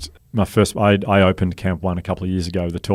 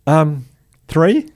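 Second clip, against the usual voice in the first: high rising nervous guess; unsure guess; 9 semitones higher, 4 dB louder than usual. Retold or imitated. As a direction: squeak it out like a bluff. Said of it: "I went".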